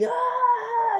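very high-pitched scream; mock victim's shriek; imitated scream. Reviewer acts out Donna being eaten by zombies, high mock scream.

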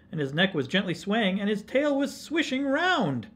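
animated storytelling, building in pitch and force; story read to children. A narrator's reading. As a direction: build the excitement for young listeners.

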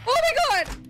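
high, loud scared shout; startled scream. Real, not acted. Player yelling at a sudden scare, high and loud. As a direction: jolt and yell at the scare.

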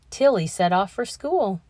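ordinary delivery

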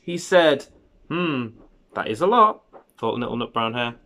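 unhurried picture-book narration with lifted key words; children's story reading. A narrator's reading. Parent reading a bedtime hare story to a child, storytelling. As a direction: read it to a little child, savouring the phrases.

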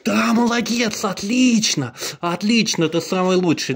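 high, loud, gushing praise for the dog; excited praise. Real, not acted. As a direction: cheer the dog on brightly. Praising dog after trick.

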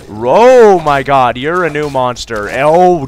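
loud, slow horrified cry; jump-scare yell; startled shriek. Real, not acted. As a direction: recoil in fright. Startled by a new monster.

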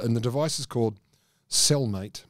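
usual speaking voice

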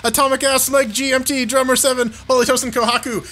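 high excited roll call; hyped credits. Real, not acted. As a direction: read names like a hype man.

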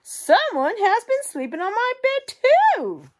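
very high, loud mother bear squeal; Mama Bear. Acted. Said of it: "Mama Bear goes to her medium-sized bed and says".

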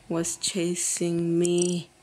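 normal talking voice

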